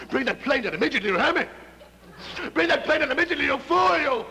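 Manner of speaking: high, loud escalating yell; frantic shouted order. Acted. Commander ordering Jim to land the plane, escalating shout. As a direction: bellow into the radio with rising urgency. Colonel yells at Jim by radio.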